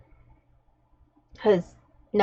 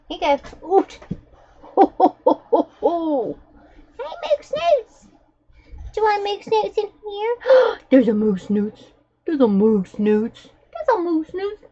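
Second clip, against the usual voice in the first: high cooing pet voice; 8 semitones higher than usual. Real, not acted. Owner doting on her dog in a high gushing coo.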